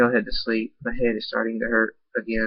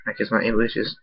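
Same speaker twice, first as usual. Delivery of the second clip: ordinary delivery, nothing marked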